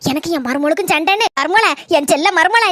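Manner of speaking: ordinary talk, nothing marked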